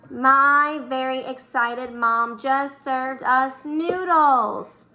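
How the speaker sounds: said slowly and clearly for the class; slow mnemonic recital. Shown as a demonstration. Teacher reading the finished mnemonic slowly to students. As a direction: spell it out at a slow teaching pace. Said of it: "M for Mercury".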